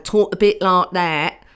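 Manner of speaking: brief mock Kent accent. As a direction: put on a bit of a Folkestone accent. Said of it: "one of them came from Folkestone in Kent".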